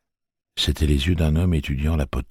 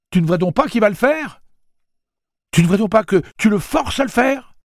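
voix très haute et forte; reproche indigné; 7 dB louder than usual. Acted. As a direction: accuse avec véhémence. Susannah accuse Roland. (Susannah accuse Roland, indignée)

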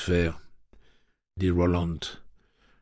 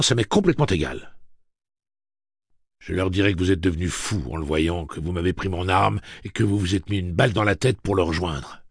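louder cold ultimatum with a few sharp rises; stern threat; 5 dB louder than usual. Acted. Roland threatens the screaming father into silence. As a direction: lay down the ultimatum, hard.

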